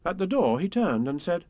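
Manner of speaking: ordinary delivery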